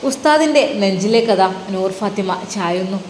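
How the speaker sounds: usual speaking voice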